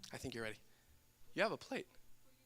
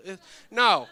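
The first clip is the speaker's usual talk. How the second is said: ordinary delivery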